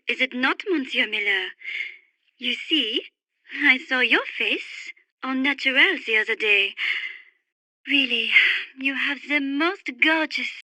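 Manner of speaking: low, soft murmur; intimate seduction. Acted. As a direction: seduce him quietly, voice dropped. Seductive talk to Monsieur Miller.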